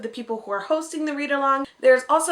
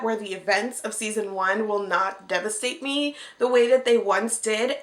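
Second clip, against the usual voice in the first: ordinary delivery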